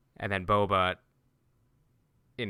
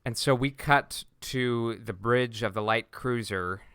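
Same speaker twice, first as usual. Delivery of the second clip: ordinary delivery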